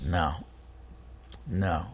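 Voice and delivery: soft no. Acted. Answers his own question.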